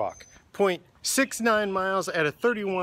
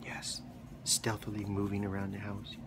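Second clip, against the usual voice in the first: hushed low voice to the puppy; soft whisper; 10 semitones lower, 14 dB quieter than usual. Real, not acted. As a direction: whisper it to the sneaking dog. Talking to puppy.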